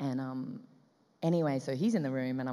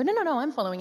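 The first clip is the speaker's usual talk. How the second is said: high, loud, quick protest; hurried reassurance; 12 semitones higher, 8 dB louder, much faster than usual. Retold or imitated. Actress assuring director she understands, hurried high protest. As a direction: insist brightly over the top of them.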